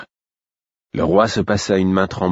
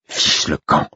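low snarled dismissal; growled; 3 semitones lower, slower than usual. A narrator's reading. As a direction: snarl it, barely voiced. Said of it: "gronda Royal".

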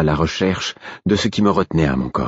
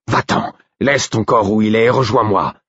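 higher, urgent command; Œil de Nuit, crying out; 5 semitones higher, 3 dB louder than usual. A narrator's reading. Wolf orders companion to leave his body, urgent cry. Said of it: "s'écria Œil de Nuit".

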